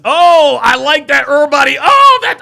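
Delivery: loud, high, thrilled yells at chat; excited shouting. Real, not acted. Streamer hyped by chat's pick, loud excited yell. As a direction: shout it out, delighted.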